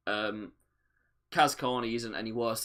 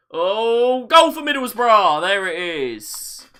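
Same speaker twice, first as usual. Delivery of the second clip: excited goal shout, louder and higher; yelling at the tv; 9 semitones higher, 12 dB louder, much slower than usual. Real, not acted. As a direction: shout it at the screen. Goal celebration shouted at the screen.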